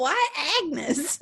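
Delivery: giggling. Acted. Girl mocks the witch's name.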